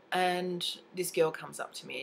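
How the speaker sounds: normal talking voice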